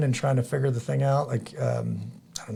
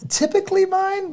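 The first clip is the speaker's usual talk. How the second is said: put-on high, slow British accent; Emily Blunt. British actress answering whose accent the kids get, British accent. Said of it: "she was like".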